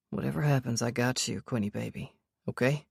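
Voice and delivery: tender reassurance. Acted. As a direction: hush it down to comfort her. Sebastian comforting her in danger.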